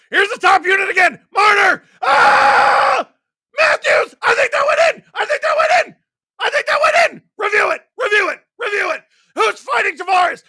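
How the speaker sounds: high frantic shrieking; shrieking jackpot winner. Real, not acted. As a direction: scream like you just hit the jackpot. Frantic reaction to a possible goal.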